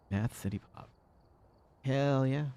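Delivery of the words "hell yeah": ordinary delivery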